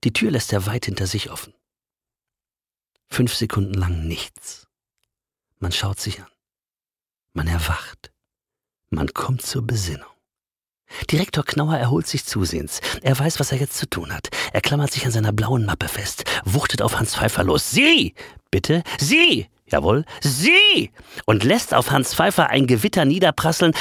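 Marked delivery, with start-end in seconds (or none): 3.1-10.1 hushed narration. A narrator's reading. Narrator hushes the listeners as both stand frozen, near-whispered. Stunned silence after exit. 17.7-20.9 high, loud outburst, last words slow; furious director. Acted. Director unleashes his storm at Hans Pfeiffer, high and loud.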